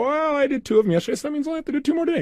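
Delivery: high-pitched, rushed imitation of an excuse; mock excuse. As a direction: imitate someone wriggling out of it, high and quick. Mimicking a listener's excuse.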